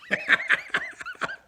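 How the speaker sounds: high burst of laughter. Real, not acted. Lawyer laughing at the clerk's answer, high laugh.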